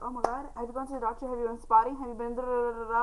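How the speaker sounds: imitating a panicked school nurse; rapid-fire, rising mock alarm; freaking out. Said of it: "She was, like, freaking out".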